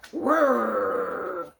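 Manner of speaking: loud mock dragon roar; a playful roar at the chickens. Dragon roaring at the chickens, loud mock roar.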